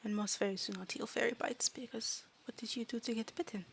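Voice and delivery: careful fairy voice, growing soft; quietly certain little fairy; matter-of-fact fairy. Acted. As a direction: explain fairy lore in simple, halting words. Fairy explains fairy bites.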